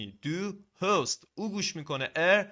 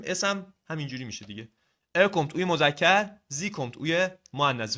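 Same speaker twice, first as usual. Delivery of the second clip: normal talking voice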